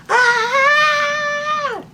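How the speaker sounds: a sustained high storytime shriek; mock scream. The frightened townspeople scream at the zombie, high and long. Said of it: "the townspeople all screamed".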